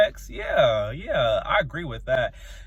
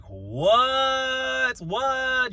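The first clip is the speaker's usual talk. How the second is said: high, stretched-out "what"; incredulous; 9 semitones higher than usual. Real, not acted. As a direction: draw it out in disbelief. Incredulous about bunk beds.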